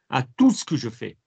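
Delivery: "À tout ce que je fais" with every word linked, no breaks; run it right through. Shown as a demonstration. Teacher showing students how "À tout ce que je fais" runs together. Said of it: "I'm running it right through".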